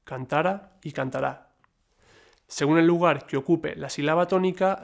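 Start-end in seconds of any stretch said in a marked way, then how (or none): 0.0-0.8 cantara stressed on the middle TA; stress on middle syllable. Shown as a demonstration. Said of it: "ya que permite distinguir palabras". 0.9-1.5 cantará stressed on the final RÁ; cantará, last syllable accented. Shown as a demonstration. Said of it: "ya que permite distinguir palabras".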